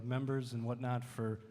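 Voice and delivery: ordinary talk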